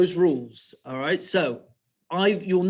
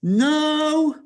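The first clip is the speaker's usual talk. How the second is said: high, loud piggy cry; long, high-pitched protest; piggy's reply; 13 semitones higher, 8 dB louder than usual. Acted. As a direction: cry out a refusal as the little pig. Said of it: "What did Piggy reply?".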